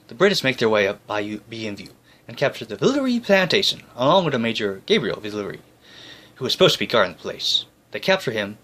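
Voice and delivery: ordinary delivery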